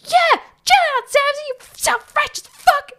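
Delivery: very high, loud mock yell; imitated aggressive yelling. Imitating Turtleneck Bro berating Samsy, aggressive high yell. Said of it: "yelling at him so aggressively".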